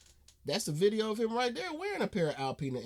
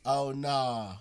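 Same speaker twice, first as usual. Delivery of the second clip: ordinary delivery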